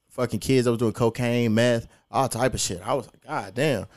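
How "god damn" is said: usual speaking voice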